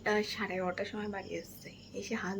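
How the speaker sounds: soft hushed whisper. Real, not acted. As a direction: whisper it so nobody hears. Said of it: "আমি আস্তে আস্তে কথা বলছি".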